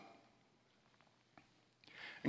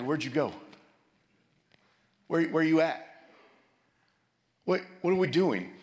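higher, louder cries to an absent God; anguished plea; 6 semitones higher, 4 dB louder than usual. Retold or imitated. Friend lamenting to God, pleading loud and high. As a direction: call out to God, lost and frustrated. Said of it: "as if he was mad at God".

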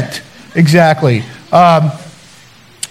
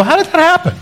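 mock surprise; 11 semitones higher, 3 dB quieter, faster than usual. Mock puzzled reaction to stuff.

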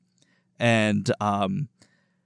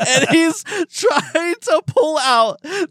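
slowed and pitched up, breaking into laughter; laughing through it; 12 semitones higher, 3 dB louder, slower than usual. Real, not acted. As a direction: lose it laughing mid-sentence. Laughing at drowning man story.

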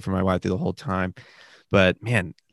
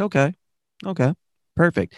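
high, bright fake-agreeing okay, much higher pitched; fake agreeable okay; 9 semitones higher than usual. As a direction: play along sweetly while hiding the truth. Fake agreement while secretly packing.

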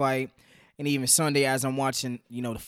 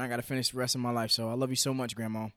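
choked up; 4 semitones lower, 7 dB quieter, faster than usual. Real, not acted. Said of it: "try not to get choke up".

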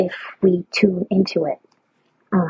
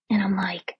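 usual speaking voice

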